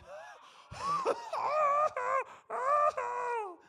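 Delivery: long high breathless wheeze-laugh; squealing laugh; wheezing high laugh. Retold or imitated. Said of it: "sounds like he's out of breath".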